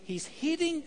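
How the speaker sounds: ordinary talk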